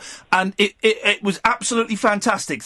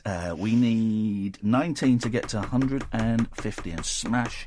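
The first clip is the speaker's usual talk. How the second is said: soft, low explaining voice; quiet; 8 semitones lower, 5 dB quieter than usual. Real, not acted. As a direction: talk it down low. Host lowers voice, explains record.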